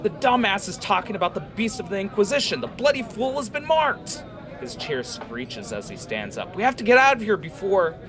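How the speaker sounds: high, fast frantic rant; aggravated panic. Acted. Jackal warning everyone about the beast, high, fast and frantic. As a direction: spill it out in rising panic.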